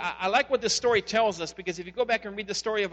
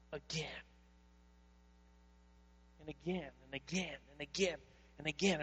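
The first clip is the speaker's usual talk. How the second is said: each again softer, sinking to a hush; fading repetition; 4 semitones lower, 11 dB quieter than usual. Real, not acted. Pastor to congregation, repeating again in a fading hush. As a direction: let the words die away into near silence.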